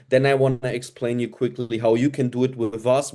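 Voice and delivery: ordinary talk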